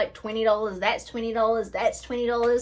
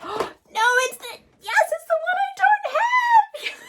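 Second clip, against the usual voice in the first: shrieking, very high and slower; ecstatic squeal; slower than usual. Real, not acted. Vlogger discovering the one product she lacks, ecstatic shriek. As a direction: squeal with pure delight.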